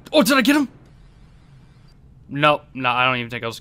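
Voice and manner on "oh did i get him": high, loud, eager question; excited hope. Real, not acted. Player checking on a missile hit, excited hope. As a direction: jump up in pitch, hoping for a hit.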